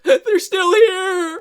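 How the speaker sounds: high, slow, loud wail; mock horror. Grandma sees the creatures are back, high horrified mock wail. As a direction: stretch it out in hammed-up dread.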